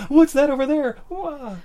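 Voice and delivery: loud, high fake alarm; hammed-up startled pointing-out; mock distraction. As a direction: call it out high like a silly decoy. Mimics failed attempt to distract attacker.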